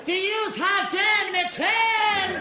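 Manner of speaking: high, loud, drawn-out yell across the room; hollering to a colleague in the next shop; screaming across the shop. Retold or imitated. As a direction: shop girl screaming to Sarah across the entrance. Screamed over at another shoe shop.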